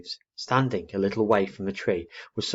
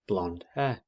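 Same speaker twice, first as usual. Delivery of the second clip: ordinary delivery, nothing marked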